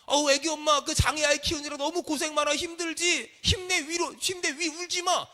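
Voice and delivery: high-pitched imitated consolation; effusive mock sympathy; gushing sympathy. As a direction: play the well-meaning comforter. Voices a gushing, unhelpful comforter.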